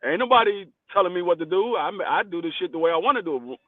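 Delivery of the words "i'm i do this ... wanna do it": normal talking voice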